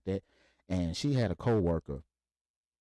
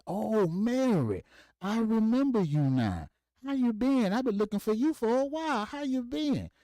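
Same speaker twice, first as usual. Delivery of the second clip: put-on dad charming an old flame; 3 dB louder than usual. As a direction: stretch out the recognition like a smooth old player.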